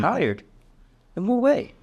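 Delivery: mirror the word back with care; echoing with concern. Shown as a demonstration. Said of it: "repeat that word back to them".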